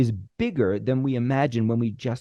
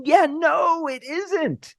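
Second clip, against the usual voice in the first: producer's reply; 5 dB louder, much slower than usual. Retold or imitated. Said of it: "maybe they go".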